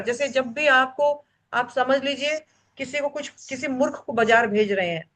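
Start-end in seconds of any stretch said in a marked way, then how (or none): none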